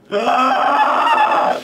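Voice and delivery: shrill high mock alien shriek; pod scream. Hero becomes a pod and shrieks at the end, high shrill shriek. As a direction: let out a piercing inhuman shriek. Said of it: "And then he just goes".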